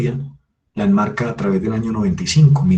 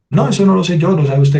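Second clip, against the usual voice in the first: the lady's retort, much higher and louder; mock client retort; 9 semitones higher, 6 dB louder than usual. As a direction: toss it back high and loud like the indignant lady. Retold quarrel with the client. (la clienta replicando, imitada con voz mucho más aguda y fuerte)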